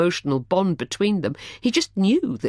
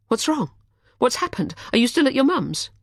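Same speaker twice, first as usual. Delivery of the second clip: worried woman; 10 semitones higher than usual. A narrator's reading. Charlotte voiced by narrator.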